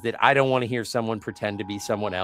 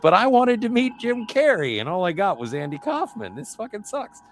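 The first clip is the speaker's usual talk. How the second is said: high-pitched, loud-starting retold gripe; put-out, high-pitched moaning; exasperated complaint; 11 semitones higher, 4 dB louder than usual. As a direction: act out a let-down fan protesting. Retold complaint of a let-down visitor.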